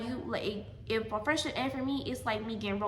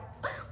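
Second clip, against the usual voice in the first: imitated crying intake of breath; sobbing gasp; 10 dB quieter than usual. Daughter on phone to mom, imitated sobbing gasp. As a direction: gasp as if starting to sob. Retold tearful call to her mom.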